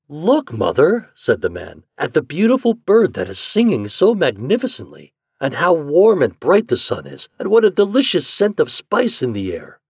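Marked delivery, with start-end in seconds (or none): 0.1-1.1 high, slow, delighted call; drawn-out, admiring exclamation; marvelling man. Acted. 2.0-9.8 high, loud, lilting wonder; delighted storybook rapture; marvelling man. Acted. Man marvels at bird and sun.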